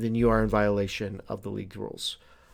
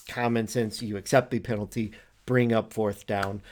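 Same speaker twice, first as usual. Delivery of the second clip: ordinary talk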